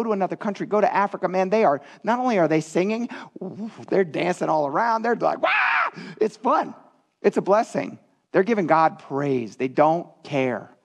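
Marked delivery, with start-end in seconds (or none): 5.4-6.0 high-pitched mock shout of joy; exuberant whoop. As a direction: whoop like an elated worshipper. Imitating exuberant African worshippers dancing.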